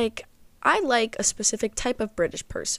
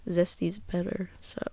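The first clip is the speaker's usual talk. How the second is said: confiding whisper; 8 dB quieter than usual. Real, not acted. Confiding aside about salad dressing.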